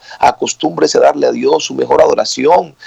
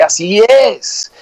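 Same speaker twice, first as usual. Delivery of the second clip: high, drawn-out affirmation; mock shout; 7 semitones higher, much slower than usual. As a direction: imitate a worshipper crying out in agreement. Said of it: "de pronto grita duro".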